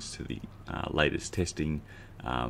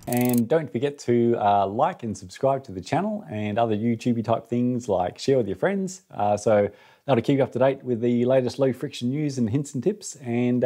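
pitched-up voice; 9 semitones higher, 7 dB louder than usual. Acted. Sped-up outro sign-off.

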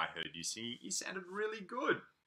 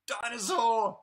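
mock scary dinosaur; 6 semitones higher, 6 dB louder than usual. Spooky reveal of dinosaur.

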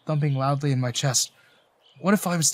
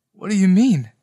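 louder, higher puzzled question; confused question; 5 semitones higher, 7 dB louder than usual. A narrator's reading. Narrator as listener asking the old man what he means, confused. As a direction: ask it loudly, pitched up in confusion. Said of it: "I frowned, confused".

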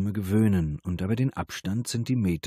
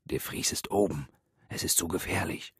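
hushed warning, much softer; whispering; 5 dB quieter than usual. A narrator's reading. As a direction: keep it down, danger is close. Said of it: "flüsterte er".

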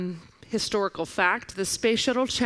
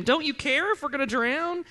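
high-pitched, indignant question; perturbed; 7 semitones higher than usual. Acted. Preacher voices disciples waking sleeping Jesus, perturbed. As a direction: plead in mock exasperation, pitched up. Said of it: "being very perturbed at this event".

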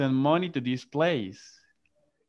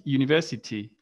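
university with no r, British style; British English university, r dropped; British pronunciation; faster than usual. Shown as a demonstration. Teacher contrasting for the class, British English university. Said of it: "and in British".

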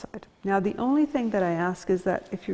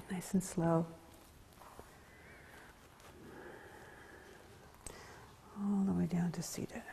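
soft yoga guidance; 2 semitones lower, 8 dB quieter than usual. Acted. Yoga teacher guides class down, soft and whispery. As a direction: ease the class gently down, barely above a breath.

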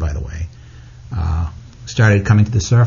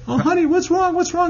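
high, concerned mother voice; imitating worried mother. Mother asking her crying son what's wrong, high and worried. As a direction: coo it like a mom soothing her child. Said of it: "And she's like".

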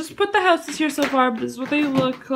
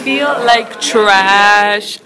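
slow drawn-out; 4 semitones lower, 6 dB louder, much slower than usual. Real, not acted. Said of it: "because I am trash".

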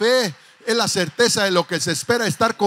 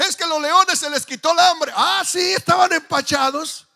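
mock excuses; 6 semitones higher, 5 dB louder than usual. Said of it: "Y ellos decían".

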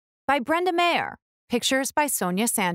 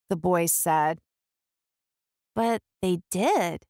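ordinary delivery, nothing marked

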